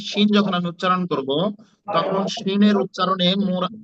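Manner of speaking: ordinary talk, nothing marked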